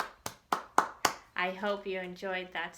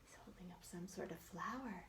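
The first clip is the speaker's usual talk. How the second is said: soft quick whispered aside; 4 semitones lower, 18 dB quieter, faster than usual. Real, not acted. Teacher describing the picture to kids in a quick whisper.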